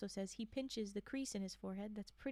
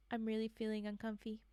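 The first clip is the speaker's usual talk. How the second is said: normal talking voice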